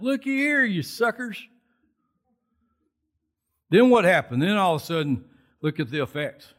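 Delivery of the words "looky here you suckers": high, gloating taunt; triumphant taunt. Retold or imitated. David holding up Goliath's head to the Philistines, gloating. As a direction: crow over the fallen giant.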